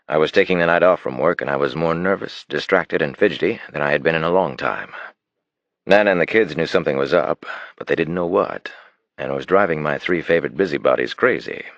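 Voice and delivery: plain reading voice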